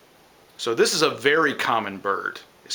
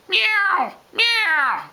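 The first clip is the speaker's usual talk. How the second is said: much higher, louder cat-like mew; catbird meow; 4 dB louder than usual. Retold or imitated. Teacher imitating the catbird's meow, much higher cat-like mew. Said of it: "it sounds like a cat".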